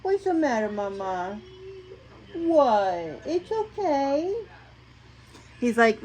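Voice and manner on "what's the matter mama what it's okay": pet talk. Real, not acted. Owner comforting her dog, high cooing voice.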